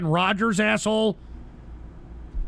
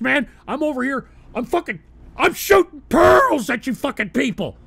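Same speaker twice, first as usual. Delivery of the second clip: normal talking voice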